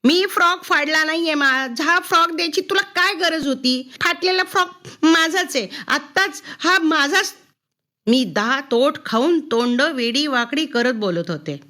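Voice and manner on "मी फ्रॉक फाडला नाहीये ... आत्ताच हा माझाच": high, pushed voice yelling at mother; screaming back. A narrator's reading. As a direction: scream your protest, pitch up high. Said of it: "जोरजोरात किंचाळून आता ओरडायला लागले मी".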